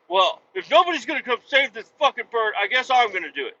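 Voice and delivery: high, loud self-sacrificing clerk; noble clerk voice. Retold or imitated. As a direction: play the clerk as a reluctant hero.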